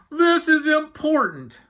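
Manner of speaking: high, loud, drawn-out moan; moaning Walrus. A narrator's reading. Walrus pleading with talkative Yak, moaning high. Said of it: "he moaned".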